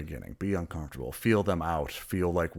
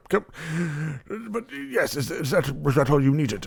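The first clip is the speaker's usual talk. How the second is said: higher, louder flustered question; nervous high host voice; 9 semitones higher, 4 dB louder than usual. Acted. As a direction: fumble out the question, pitched up.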